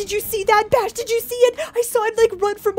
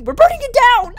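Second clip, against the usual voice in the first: higher, louder insistence; panicked declaration; 9 semitones higher, 7 dB louder than usual. Real, not acted. Fleeing the haunted house with friends, higher, louder insistence. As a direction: shout the plan while fleeing.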